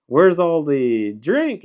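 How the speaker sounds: drawn out, baffled boss; employer. Retold or imitated. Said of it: "employer was like".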